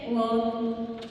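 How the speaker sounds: ordinary talk, nothing marked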